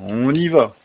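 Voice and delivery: tail end of an acted yawn. A narrator's reading. Rip rousing his dog Wolf, yawning. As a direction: let a slight yawn carry the words. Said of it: "dit-il en bâillant".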